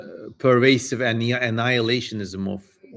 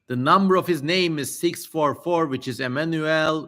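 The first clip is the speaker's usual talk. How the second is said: raised, declaiming scripture voice; solemn reading; 4 semitones higher than usual. Acted. Streamer reading the demon's prophecy to chat, solemn declaiming. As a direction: read it like an old holy text. Reads ancient text aloud.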